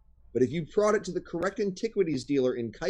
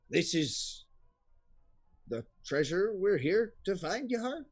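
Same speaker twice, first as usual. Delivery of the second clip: unhappy ogre; 3 semitones higher, 4 dB quieter than usual. Acted. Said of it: "they are not happy".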